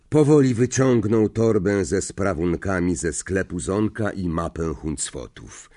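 ordinary delivery, nothing marked

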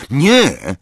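loud, high protest; calling out. A narrator's reading. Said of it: "zawołał Snape".

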